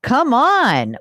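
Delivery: high, loud, incredulous exclamation; disbelieving. Real, not acted. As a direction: burst out in happy disbelief. Disbelief at caller's retirement income.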